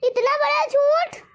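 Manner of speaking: slow, pitch leaping high on the lie; a stunned, theatrical gasp at the betrayal; shocked outrage. Acted. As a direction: melodramatic mother-in-law aghast. Mother-in-law shocked by the lie.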